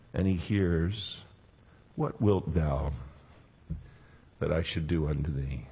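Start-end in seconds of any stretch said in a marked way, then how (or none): 2.0-5.7 tender yet powerful. Acted. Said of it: "How tender, how powerful".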